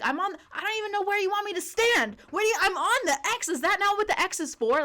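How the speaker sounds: high, loud imitation of a yelling customer; mock freak-out. Customer yelling at store staff about where to stand, mock freak-out. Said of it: "like, yell".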